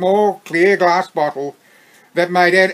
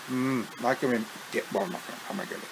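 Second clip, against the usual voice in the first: muttered, much softer; quiet aside; 7 semitones lower, 15 dB quieter, much faster than usual. Real, not acted. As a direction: mutter it off to the side. Off-script aside.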